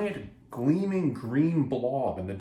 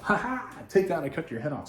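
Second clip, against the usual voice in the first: gloating laugh; 6 semitones higher than usual. Acted. Gloating after beheading foe.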